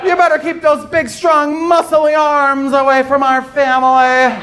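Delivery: high, slow mock-outraged voice that turns flirty; homophobic bigot. Comedian mocking a homophobic parent protecting the family, high outraged voice. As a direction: ham up a closeted bigot. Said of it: "they're just like".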